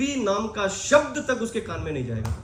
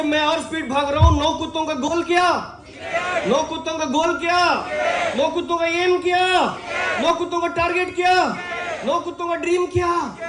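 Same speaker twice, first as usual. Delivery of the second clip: high, chant-like repeated questions; rousing crowd quiz; 7 semitones higher than usual. Acted. Speaker quizzing audience on nine dogs' goal, rousing chant. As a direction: call each question out high for a response.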